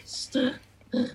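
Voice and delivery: str with the R made at the back of the throat; whispery back-of-throat r; str, soft guttural r; back R demo. Shown as a demonstration. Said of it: "pronounced the R at the back".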